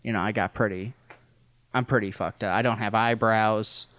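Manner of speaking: usual speaking voice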